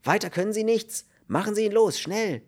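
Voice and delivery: high, urgent scolding; angry shouting. A narrator's reading. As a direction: berate him into acting. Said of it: "schrie Kern". (wütend und drängend geschrien)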